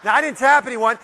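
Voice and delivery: ordinary delivery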